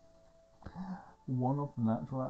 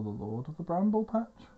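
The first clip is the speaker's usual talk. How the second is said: grand proclamation; 7 semitones higher, 4 dB louder than usual. Acted. Owain names the trial's foe, grand proclamation. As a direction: LORD OF THE BRAMBLE PATCH raised high, in character. Grand title read in character.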